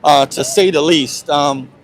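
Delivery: loud voice over crowd noise; talking over the crowd. Real, not acted. Reporter at loud protest.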